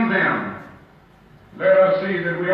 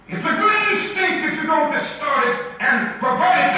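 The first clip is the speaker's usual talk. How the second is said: much higher, ringing fervour; fervent climax; 7 semitones higher than usual. Pretend. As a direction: lift it to a soaring, urgent cry. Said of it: "He said".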